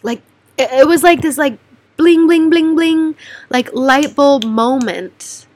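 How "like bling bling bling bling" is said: high, loud imitated ding of an idea; bling sound effect. Describing her breakthrough in therapy, a bright mock chime. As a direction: ring out the lightbulb noise playfully. Light bulb moment realization.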